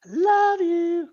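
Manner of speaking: very high, drawn-out cooing; playful high voice. Pretend. Co-host to everybody with wiener patches, playful high voice.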